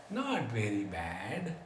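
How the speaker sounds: slow, low, soft mock grudging answer; unenthusiastic reply. Old man in the sun answers a greeting, unenthusiastic. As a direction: draw it out low and quiet, imitating lukewarm contentment.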